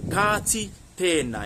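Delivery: slow, drawn-out long vowels in "Ka ti tēnā"; lange klinkers. Shown as a demonstration. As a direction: stretch the vowels of "Ka ti tēnā", word by word. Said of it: "there's some long vowel sounds in there".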